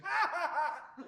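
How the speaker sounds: loud, high, slow mock guffaw; hammed-up belly laugh, slow and loud; booming laugh. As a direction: imitate a director roaring with laughter at his own jokes. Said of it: "Literally that loud".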